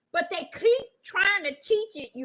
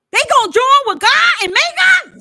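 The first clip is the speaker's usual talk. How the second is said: much higher, louder cry of disbelief; incredulous shout; 10 semitones higher, 11 dB louder than usual. Real, not acted. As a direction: shout the question out to the crowd. Preacher exclaiming at the prophecy.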